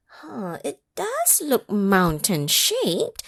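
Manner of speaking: ordinary delivery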